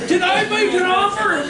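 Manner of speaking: loud, high incredulous question; retold disbelief. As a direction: play up the disbelief for laughs. Disbelieving reply, played for laughs.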